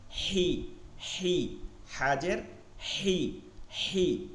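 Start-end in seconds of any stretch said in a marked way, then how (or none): 3.0-4.3 হি stretched very slowly; drawn-out hi. Shown as a demonstration. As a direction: draw হি out long. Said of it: "আমার মতন হয় কিনা". (খুব ধীরে টেনে হি)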